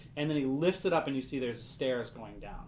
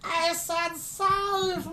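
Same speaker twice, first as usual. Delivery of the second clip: much higher and louder, insisting; loud high correction; 4 dB louder, much slower than usual. Acted. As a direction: shout it clearly so they hear. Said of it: "I misheard you".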